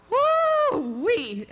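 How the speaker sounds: high, loud whoop of amazement; exclaiming in awe. Real, not acted. Speaker marvels at a man's fervent prayer, whooping loudly. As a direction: let out a big high whoop.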